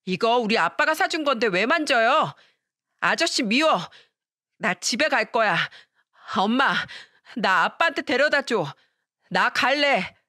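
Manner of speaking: put-on a five-year-old screaming at his stepfather over a toy; lightly voiced angry little boy; angry child shouting. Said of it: "화를 내면서 소리를 치고".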